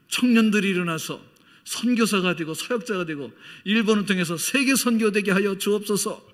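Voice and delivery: high, loud pleading prayer; rising impassioned petition to God; fervent prayer. Retold or imitated. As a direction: pray it out with full fervour.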